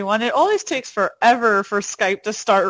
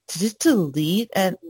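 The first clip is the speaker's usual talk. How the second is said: ordinary talk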